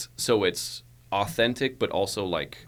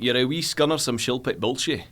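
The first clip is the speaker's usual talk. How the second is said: Scottish accent, a bit higher; 3 semitones higher than usual. Shown as a demonstration. Actor shows his Scottish warm-up line, Scottish accent. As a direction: roll into a Scottish accent with that phrase. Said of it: "if I'm doing Scottish, right, I'll go like".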